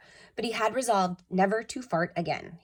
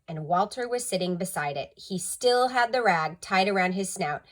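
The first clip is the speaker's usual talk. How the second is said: plain reading voice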